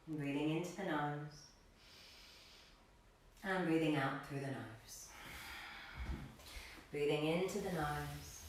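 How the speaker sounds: calm yoga teacher cueing breaths, long pauses; guided meditation. Real, not acted. Yoga teacher settling class into a seated position, guided meditation. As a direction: settle them gently into stillness, phrase by phrase.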